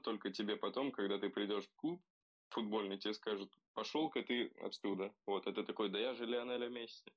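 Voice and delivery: usual speaking voice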